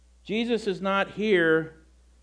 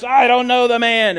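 cursing denial; 5 semitones higher, 9 dB louder than usual. Retold or imitated. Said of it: "Peter began to swear and to curse".

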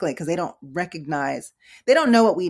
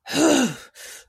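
loud imitated huff; upset huff; 8 semitones higher, 5 dB louder than usual. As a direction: huff hard like someone upset. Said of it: "He was upset".

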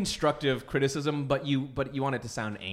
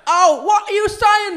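very high, loud, drawn-out English caricature; rough English accent; 12 dB louder, slower than usual. Acted. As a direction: holler it in cartoon English dialect. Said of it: "and mine is just like".

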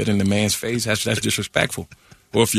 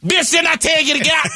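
Madea voice. Acted. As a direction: scream them out of the house as grandma. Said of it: "I created Madea".

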